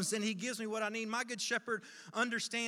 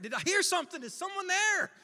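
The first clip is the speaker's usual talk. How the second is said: high, urgent questioning; startled searching; 9 semitones higher, 4 dB louder than usual. Acted. Lost man thinking he heard someone, startled. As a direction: call out, unsure who is there.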